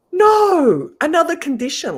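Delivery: high, loud mock wail; exasperated protest. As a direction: whine it like a thwarted kid. Retold protest at mum's condition.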